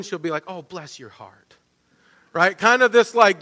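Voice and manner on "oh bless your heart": Southern woman. Acted. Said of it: "good Southern woman".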